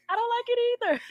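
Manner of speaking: high, tearful laughing voice; laughing on the edge of crying. Real, not acted. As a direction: squeeze it out through laughter and tears. Both teary, host cries laughing.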